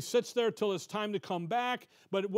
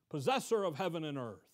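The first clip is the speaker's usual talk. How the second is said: normal talking voice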